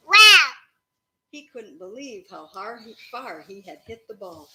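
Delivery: ordinary delivery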